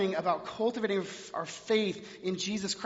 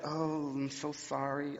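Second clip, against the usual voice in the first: much lower, softer, slow inner groan of embarrassment; inner apology; 7 semitones lower, 10 dB quieter, slower than usual. Retold or imitated. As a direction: cringe it quietly to yourself. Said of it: "my head was like".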